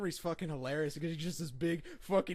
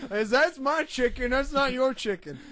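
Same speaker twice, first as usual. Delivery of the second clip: dim brute; 9 semitones higher, 9 dB louder than usual. Retold or imitated. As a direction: play him dim and grabbing. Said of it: "it's like".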